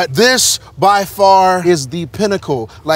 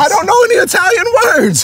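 excited high yell; 5 dB louder than usual. Real, not acted. Jokey outburst about Italian.

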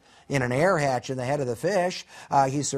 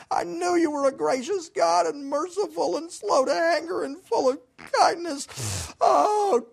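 despairing Jonah; 12 semitones higher than usual. Retold or imitated. Jonah begging God to take his life, high crying whine. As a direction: wail it like a sulky prophet who wants to die. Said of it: "And he said".

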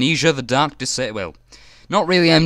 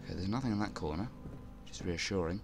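nervous hush; 6 semitones lower, 16 dB quieter, faster than usual. Real, not acted. Checking the corner nervously.

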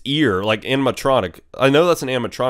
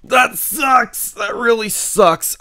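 frustrated; 11 semitones higher, slower than usual. Real, not acted. Loses the night.

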